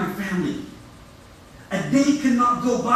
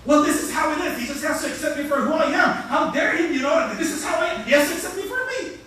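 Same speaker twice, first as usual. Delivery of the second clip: imitating the sister's raised voice; 6 semitones higher than usual. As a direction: mimic her raising her voice. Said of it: "she's raising her voice at me now".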